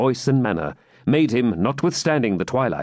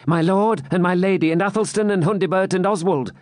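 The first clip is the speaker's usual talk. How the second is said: higher, light-toned jester; 9 semitones higher than usual. A narrator's reading. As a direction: play it as the wry jester. Jester voiced in story.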